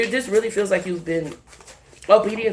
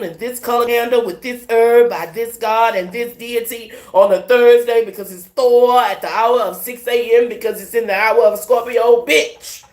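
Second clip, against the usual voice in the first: rising, louder excited rant; 4 semitones higher, 6 dB louder than usual. Real, not acted. Telling viewers how witches time their spells, getting louder. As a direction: build it up, louder and higher with each detail. Ranting about witches' timing.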